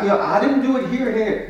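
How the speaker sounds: mock defensive spouse. Acting defensive reply.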